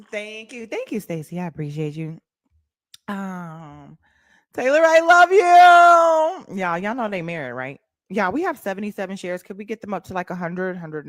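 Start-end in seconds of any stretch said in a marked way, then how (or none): 4.5-6.6 drawn-out love call. Real, not acted. Greeting a viewer warmly.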